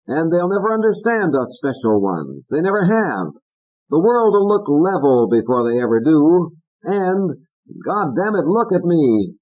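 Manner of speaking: harangue Corky; Fats ranting. Acted. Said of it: "Fats blasted on".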